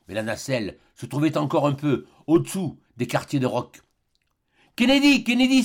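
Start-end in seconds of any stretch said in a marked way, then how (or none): 4.8-5.6 high, loud desperate call; doctor crying out to Kennedy. Acted. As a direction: yell his friend's name in panic. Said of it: "s'écria le docteur".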